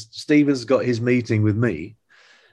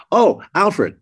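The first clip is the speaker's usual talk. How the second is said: lightly imitated director's casual afterthought, pitched higher; Steven Spielberg; 5 semitones higher than usual. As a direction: toss out a retold question on parting. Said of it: "he says".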